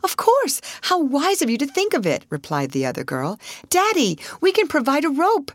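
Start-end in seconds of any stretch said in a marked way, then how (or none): 0.0-2.2 very high, eager exclamation; delighted agreement. A narrator's reading. Sister delighted at clever idea. 3.7-5.5 high, excited call to father; eager cry. A narrator's reading. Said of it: "she cried".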